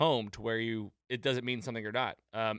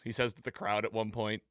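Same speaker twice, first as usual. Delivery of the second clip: normal talking voice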